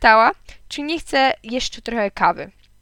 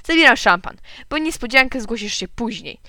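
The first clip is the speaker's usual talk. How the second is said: angry retort; 2 semitones higher, 7 dB louder than usual. A narrator's reading. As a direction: hit back at the insults. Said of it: "On też wyglądał na rozgniewanego".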